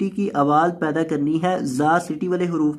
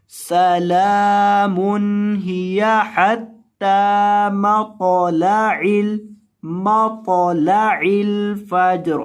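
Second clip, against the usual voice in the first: slow, drawn-out Quranic recitation; 3 semitones higher, much slower than usual. Shown as a demonstration. Teacher reciting the verse slowly for students. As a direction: chant each word long and even.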